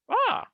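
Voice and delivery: sudden high loud "ah"; saying ahh. Shown as a demonstration. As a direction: belt out a quick high ah. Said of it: "the face will go".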